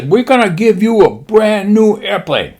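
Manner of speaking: high mock-Japanese admiral voice. Admiral briefing his pilots, high mock-Japanese voice. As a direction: announce it in a put-on Japanese accent. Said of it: "He says".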